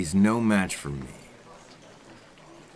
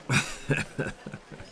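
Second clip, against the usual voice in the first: quiet breathy chuckle; a low, breathy laugh under the breath; soft breathy laugh; 4 dB quieter than usual. Acted.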